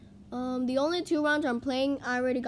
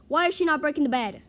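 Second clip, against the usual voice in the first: loud, fast, raised question; exasperated disbelief; 4 semitones higher, 7 dB louder, faster than usual. Real, not acted. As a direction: fire it out in frustration. Teammate won't attack the bed.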